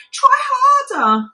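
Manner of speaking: high, loud, drawn-out cry of try harder; urgent mew. A narrator's reading. Kitten urging the fairy doll, high and loud. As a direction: urge her on loudly, slowly. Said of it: "mewed Winkle".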